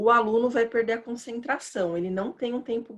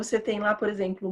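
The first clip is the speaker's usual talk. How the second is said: ordinary talk, nothing marked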